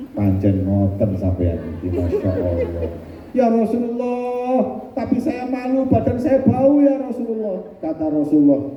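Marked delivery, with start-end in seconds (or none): 0.1-2.9 much lower, gentle amused voice; smiling Prophet. Acted. 3.3-7.6 ashamed plea. Acted. Ashamed man to Prophet.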